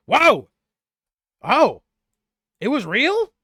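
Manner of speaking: high, loud amazed gasps, voice shooting up; stunned that it was real all along; wide-eyed astonished cry; amazed disbelief. Acted. NPC amazed the monster was real.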